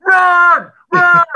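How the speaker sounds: full-throated yell from the sideline; loud sideline shout; screaming run. Retold or imitated. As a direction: scream it at the runner. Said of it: "I scream and I go".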